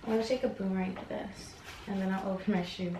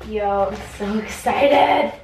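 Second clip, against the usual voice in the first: excited; 10 dB louder, slower than usual. Real, not acted. Shoes fit.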